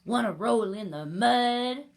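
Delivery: loud put-on pig voice; pig character. A narrator's reading. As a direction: ask it loudly as the pig. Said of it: "grunted the pig".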